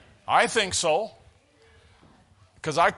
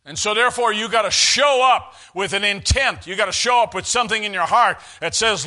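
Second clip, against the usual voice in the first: raised voice, punching the key words; full-force preaching; 4 dB louder than usual. Real, not acted. Preacher urging the church to show up with intent, full-force preaching.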